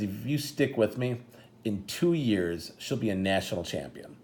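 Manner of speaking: understated, deliberate solemn vow; deadpan serious. Retold or imitated. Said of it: "he just had this deadpan serious face".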